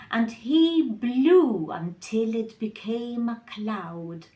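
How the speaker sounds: plain reading voice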